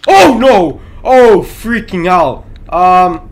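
loud, high panicked yell; startled scream. Real, not acted. Gamer caught by a scare, yelling in panic. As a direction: shriek as the monster appears. Jump scare in horror game.

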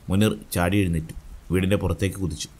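usual speaking voice

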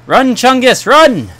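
high, loud yell to flee; panicked shout. Real, not acted. Gamer yelling at a teammate to run, panicked shout. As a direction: scream at Chungus to run for it. Fleeing a monster in game.

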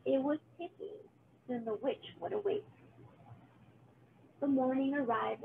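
ordinary delivery

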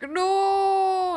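loud high drawn-out "no"; long, high exclamation of sympathy; dismayed cry. Real, not acted. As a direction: wail it out in dismay. Dismay at a missed trick.

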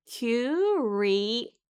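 "Curious" drawn out syllable by syllable; careful pronunciation. Shown as a demonstration. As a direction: say "Curious" slowly, parting cu, ri, ous. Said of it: "주의하세요". ("Curious"를 한 음절씩 천천히 끊어서)

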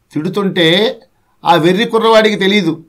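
ordinary talk

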